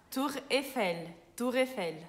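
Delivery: slow, careful French "Tour Eiffel"; French pronunciation. Shown as a demonstration. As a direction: say "Tour Eiffel" as in France, OU as "u", final R in the throat. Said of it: "lá na França é Tour Eiffel". ("Tour Eiffel" pronunciado devagar em francês)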